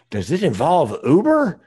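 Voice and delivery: alarmed Uber driver checking he's not the plan; worried driver. Retold or imitated. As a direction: ask it slowly as the nervous young driver. Driver's worried punchline, retold.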